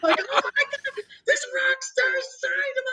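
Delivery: very high, squealing fan imitation; mock an excited yell in a high voice; screaming fangirls. Imitating women in the van as the rock star signs, squealing. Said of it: "They're all yelling".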